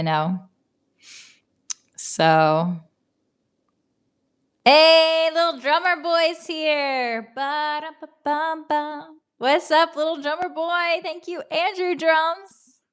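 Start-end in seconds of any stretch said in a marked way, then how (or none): 4.6-7.3 high, slow singsong welcome; excited greeting. Real, not acted. Welcoming the drummer viewer, high and drawn out. 7.4-9.2 slow, high sung drum noise; mock drum beat. A playful drum beat for the drummer viewer. 9.4-12.8 high, slow singsong hello; excited greeting. Real, not acted. Greeting viewer Andrew, high and singsong.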